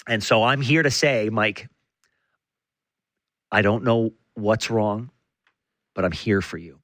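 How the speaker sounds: stage a grave heart-to-heart, pitched low; mock-serious support. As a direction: pretend intervention, hushed pitch with long pauses. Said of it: "something a little serious".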